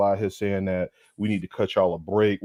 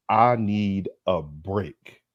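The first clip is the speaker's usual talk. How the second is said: 1 slow drawn out; 2 semitones lower, much slower than usual. Retold or imitated. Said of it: "The man said".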